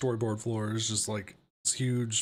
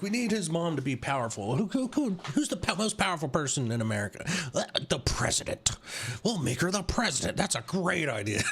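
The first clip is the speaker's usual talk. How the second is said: mock writers; 4 semitones higher than usual. Mocking the writers' planning.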